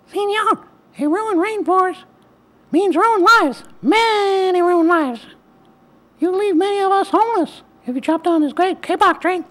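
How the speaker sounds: tiny high squeaky frog voice. A narrator's reading. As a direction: pipe it high and small like a frog. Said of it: "In a squeaky voice".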